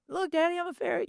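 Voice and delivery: put-on very high child voice; dad voicing his son, very high child voice; little boy voice. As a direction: squeak it like a proud little boy. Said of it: "son voiced in story".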